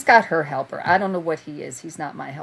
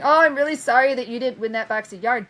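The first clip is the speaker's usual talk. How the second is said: a higher, sugary mock sales pitch; mocking impression; 7 semitones higher than usual. Mocking the rival handing out cards, a saccharine mock pitch. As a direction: parody her fake sweetness.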